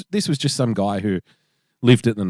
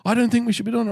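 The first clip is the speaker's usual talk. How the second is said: higher imitating a frightened whine; mock panicky backpacker; 12 semitones higher, faster than usual. The Dutch guy refusing to enter the naval base, higher mock panicky whine. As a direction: play a jittery newbie refusing.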